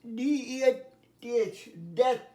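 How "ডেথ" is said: ডেথ with a short e, punched louder; short e sound. Shown as a demonstration. Teacher showing pupils the e sound, ডেথ said short and loud. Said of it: "এ দিয়ে কি দেখাচ্ছি".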